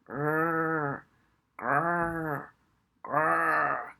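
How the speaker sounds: slow, rhythmic dog growl; lulling growl. Acted. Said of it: "slower and more rhythmical".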